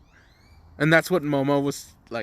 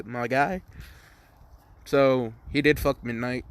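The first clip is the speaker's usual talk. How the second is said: usual speaking voice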